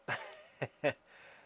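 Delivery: short breathy chuckle; real laugh. Real, not acted. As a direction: chuckle at your own blunder. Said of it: "I'm laughing because I just".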